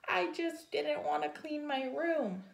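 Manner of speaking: high, little sheepish confession; small voice. Acted. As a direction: confess like a tiny bird. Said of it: "he said in a small voice".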